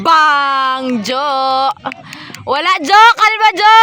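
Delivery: loud, high yelling; shouted encouragement. Real, not acted. Spectator telling a player to calm down, high loud shout. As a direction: holler support across the court.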